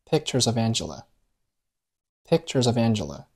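pictures of Angela run together as one smooth slow chain; linked words. Shown as a demonstration. Teacher showing students linking, pictures of Angela blended. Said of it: "三个单词连读成了".

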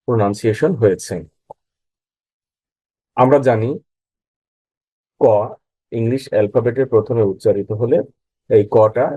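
ordinary talk, nothing marked

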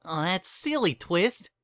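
high-pitched candy maker voice; Bon Bon. A narrator's reading. Candy maker answers her young assistant, high character voice. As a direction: lift the voice high to answer a child.

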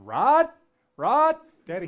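high, loud call up a tree. Retold or imitated. Little boy calling up the tree to the missing Rod, loud call. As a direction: shout the name up into the branches.

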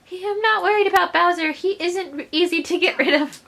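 high, put-on princess voice; her voice. Princess not worried about Bowser, high character voice. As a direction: reassure them high, as the princess. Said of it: "Oh, that's her voice".

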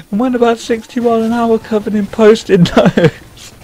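high, words bubbling with laughter; real giggling punchline; laughing. Real, not acted. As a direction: crack up as you finish the line. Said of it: "I can't tell this joke without laughing".

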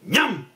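quick cartoon gulp; sudden "ñam" with a swooping pitch; chomp noise. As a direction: imitate a beast snapping up its prey. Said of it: "ouviuse un".

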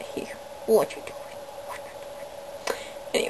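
slow breathy whisper to a pet. Real, not acted. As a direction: whisper to the bunny. Whispering to rabbit on shoulder.

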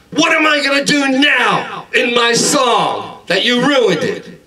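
high-pitched comic rant, easing slightly; shouted scolding. Acted. Customer to worker who ruined his song, high yelling complaint. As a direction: wail the grievance in a high, drawn-out yell.

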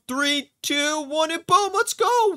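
high voice climbing to a cheer; excited countdown. Real, not acted. Player about to escape the gym, excited countdown. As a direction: count down, rising into a celebration.

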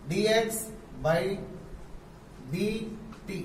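symbol by symbol with pauses; teacher spelling out d x by d t; slow spelled-out reading. Shown as a demonstration. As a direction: dictate it slowly. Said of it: "ऐसे पढ़ेंगे".

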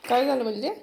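loud questioning call across the house; mother calls loudly to her returning daughter. Real, not acted.

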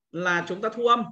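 normal talking voice

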